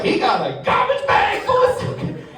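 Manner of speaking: high, mocking gossip voice; mock him, pitched high; airline worker voice.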